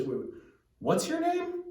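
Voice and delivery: high-pitched puzzled double take; baffled. Retold or imitated. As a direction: ask in mock bafflement, pitch leaping up. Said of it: "Alexander the Great is a little baffled".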